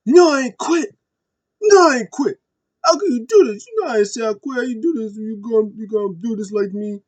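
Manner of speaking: high, loud, pleading wail; emotional Jey. Retold or imitated. Jey pleading with his cousin Roman, in a high, loud, hurt wail. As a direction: plead at the top of your voice, hurt and betrayed. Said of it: "Jey was all emotional".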